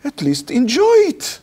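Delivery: high rising punchline; emphatic exclamation. Retold or imitated. Rabbi advising the shocked girl, high emphatic exclamation. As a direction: land the advice with a flourish.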